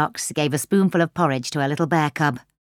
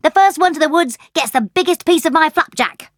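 excited challenge; 10 semitones higher, 3 dB louder than usual. Acted. Racing challenge to friends.

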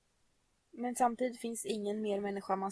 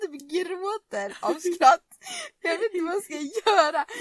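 real laughter, louder, slow, breaking through; laughing hard; 5 semitones higher, 8 dB louder than usual. Real, not acted. Retelling the hamburger fight to a friend, laughing. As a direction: talk through fits of giggles.

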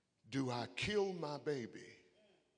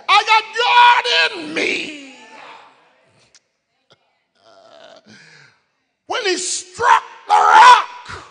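high, loud, shouting preacher; full-force preaching; 10 semitones higher, 22 dB louder than usual. Acted. Pastor shouting the climax to the congregation, full-force preaching. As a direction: preach it at the top of your voice.

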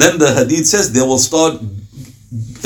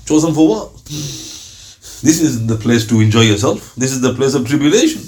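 ordinary talk, nothing marked

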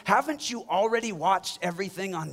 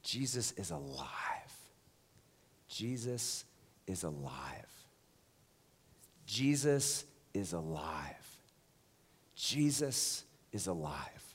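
hushed proclamation; 11 semitones lower, 11 dB quieter than usual. Real, not acted. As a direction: breathe it out reverently.

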